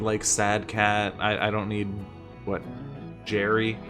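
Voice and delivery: ordinary talk, nothing marked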